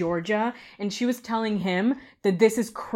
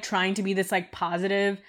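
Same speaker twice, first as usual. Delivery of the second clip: ordinary delivery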